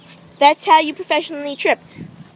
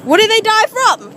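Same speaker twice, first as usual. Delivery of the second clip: higher, insistent repeated question; pressing, raised-voice question; insistent demand; 7 semitones higher, 4 dB louder than usual. Acted. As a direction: raise your voice to demand an answer. Repeats question to someone not answering.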